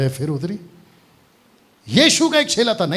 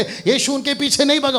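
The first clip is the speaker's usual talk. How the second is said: ordinary talk, nothing marked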